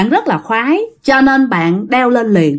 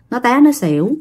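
plain reading voice

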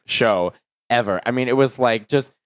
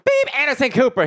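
high-pitched buzzer-happy contestant; imitated eager contestant; 12 semitones higher than usual. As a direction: mimic a contestant blurting after a high beep. Mimics drunk contestant buzzing in early.

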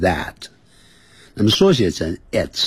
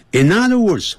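响亮提示短语; 11 semitones higher, 4 dB louder than usual. Shown as a demonstration. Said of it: "换言之".

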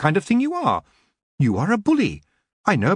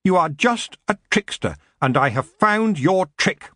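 raised pitch, firm accusation; mild shout; 7 semitones higher than usual. A narrator's reading. Geoffrey confronts the battered prince, mildly shouting. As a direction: call out the trickster, pitch lifted. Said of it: "he shouted".

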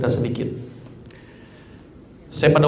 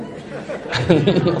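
short burst of real laughter; chuckling aside to the audience; laughing; 4 semitones higher than usual. Real, not acted. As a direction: laugh at your own joke. Laughs at own joke.

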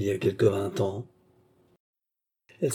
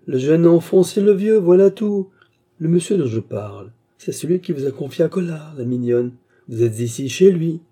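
high, loud, triumphant taunt; savage gloating; 7 semitones higher, 8 dB louder than usual. A narrator's reading. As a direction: reveal the trap with cruel relish. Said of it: "avec son rire de bête fauve". (triomphe cruel, voix haute et forte)

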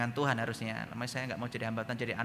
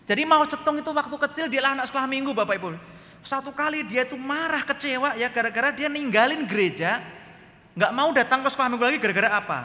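high, loud preacher's telling; fired-up preaching; 11 semitones higher, 7 dB louder than usual. Acted. Preacher booming the story to the church. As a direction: preach it out big to the congregation.

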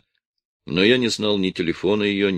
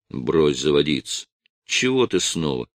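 ordinary delivery

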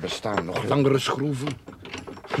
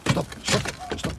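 whispered stop, stop, stop; urgent whisper; slower than usual. Acted. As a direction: hiss it under your breath. Digging at night, stopping partner.

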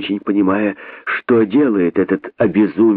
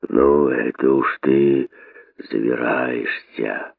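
put-on a lower, slower old snake scolding; lower, slow boa voice; 7 semitones lower, 3 dB quieter, slower than usual. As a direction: drag it out low like a boa. Desert boa scolds the rabbit.

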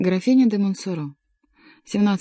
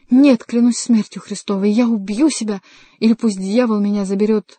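desperate exclamation; 3 semitones higher, 6 dB louder than usual. A narrator's reading. Young man swears to Henri he will kill himself, exclaiming. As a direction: cry it out forcefully, then ease slightly. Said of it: "воскликнул молодой человек".

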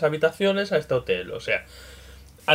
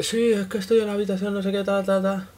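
imitating a guest; 6 semitones higher than usual. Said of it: "Pero dice".

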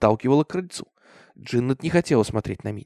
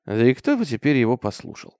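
ordinary delivery

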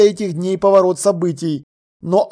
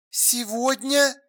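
slow, high, soft astonished question; 6 semitones higher, 8 dB quieter, slower than usual. A narrator's reading. Alla hearing the expertise is today, astonished. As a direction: stretch it out in hushed amazement. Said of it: "изумилась Алла".

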